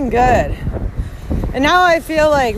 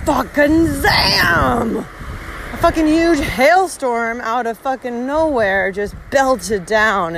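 slow dramatic; much slower than usual. Retold or imitated. Hailstorm hits.

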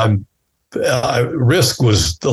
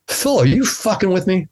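high disbelief; 9 semitones higher than usual. Retold or imitated. Retold disbelief.

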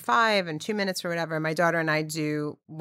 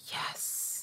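hushed whispered yes, far softer; mother whispering reverently to her child; 11 dB quieter than usual. Retold or imitated. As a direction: breathe the answer in awe. Hushed answer in cave.

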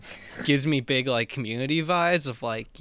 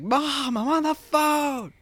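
high, slow mock-intense line to mom; a hint of an action hero snapping at his mom; lightly voiced Jack Bauer snapping at mom; mock intense snap; 12 semitones higher, 4 dB louder, much slower than usual. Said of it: "he's just instantly like".

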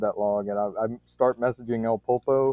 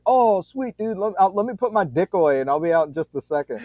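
imitating Pulpo; 7 semitones higher than usual. Pulpo caught in car, voiced.

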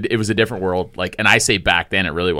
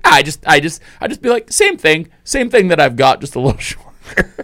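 higher, louder mock voice breaking into laughter; imitating Brent while laughing; 8 semitones higher, 5 dB louder than usual. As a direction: play the clueless guy but can't keep a straight face. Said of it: "He's just like".

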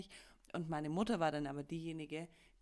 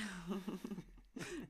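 stifled giggles; 3 semitones higher than usual. Real, not acted. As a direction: giggle under the breath. Laughs at granddaughter story.

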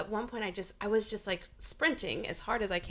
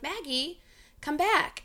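calling out; 7 semitones higher, slower than usual. Retold or imitated. Mother calling her daughter back across the park, high calling. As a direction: call the runaway kid back, pitch climbing.

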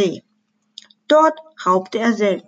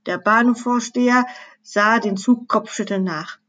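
plain reading voice

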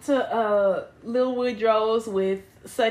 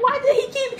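laughing; 11 semitones higher, faster than usual. Real, not acted. Laughing disbelief.

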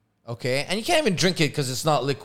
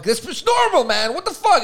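indignant protest; 10 semitones higher, 6 dB louder than usual. Retold or imitated. Retold argument with Noor.

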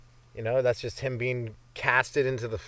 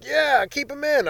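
much higher, slow mocking cheer; mock Trump fan; 12 semitones higher, 3 dB louder, slower than usual. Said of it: "People fighting for Trump, like".

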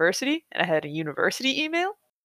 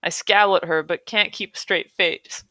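usual speaking voice

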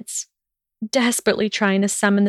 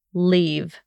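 cold command. Acted. Draco ordering her out.

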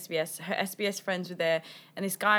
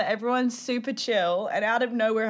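usual speaking voice

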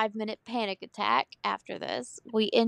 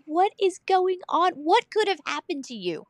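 high-pitched mock exasperation; exasperated; 6 semitones higher than usual. Mom to her screaming son, exasperated. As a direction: demand answers in playful disbelief.

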